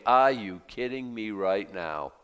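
usual speaking voice

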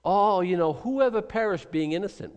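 smug, sing-song mock of a know-it-all; mocking Eliphaz. Preacher mocking Eliphaz's glib line, smug sing-song. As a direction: lift it up high and smug. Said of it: "So here's his big".

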